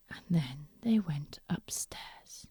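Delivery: ordinary delivery, nothing marked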